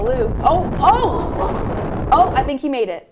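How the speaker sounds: high, loud excited gasps. Real, not acted. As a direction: cheer him down the hill. Anchor watches reporter sled live.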